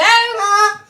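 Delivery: high, loud tiny-voiced yelp; acted little scream. Little gnome being eaten, high acted scream. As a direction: cry out like a small trapped creature.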